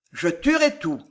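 ordinary delivery

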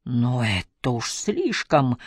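usual speaking voice

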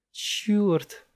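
very high shocked cry; shocked curse. A narrator's reading. Andy seeing the body, a high shocked cry. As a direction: recoil at the sight.

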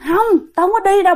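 high, fast acted protest; defiant refusal. Great-grandmother resisting her dead sister's pull, defiant refusal. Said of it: "bà cố đâu có chịu".

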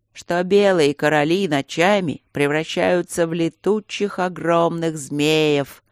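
slow, sepulchral spooky voice; creepy butler. Acted. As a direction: drag it out like a ghost story. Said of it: "загробным голосом произнёс Берни". (замогильный, зловещий голос)

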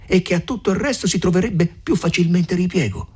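lightly voiced older woman pressing her plan; a hint of a shrewd mother urging them on; Agnese, eager. A narrator's reading. Mother urging the young couple, lightly voiced. Character dialogue, raised voice.

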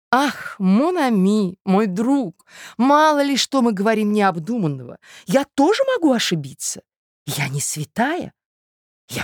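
theatrical matron, cajoling then gushing; effusive society lady. Acted. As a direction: talk him round with grand, swooping intonation.

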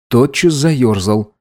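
usual speaking voice

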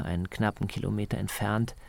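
ordinary talk, nothing marked

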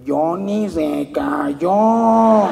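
very slow, mock nasal, garbled words; punchline in a joke, mimicking the nasal man; nasal speech defect. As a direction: drag out the punchline in the nasal man's voice. Nasal man finally speaks.

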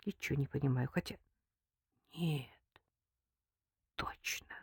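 soft, halting inner voice; hushed, fading to a whisper; bewildered thought. A narrator's reading. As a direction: puzzle it out under your breath. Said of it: "растерянно подумала Лика".

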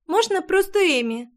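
high little squeak; squeaky. Acted. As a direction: squeak it like a small girl. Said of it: "пискнула девочка".

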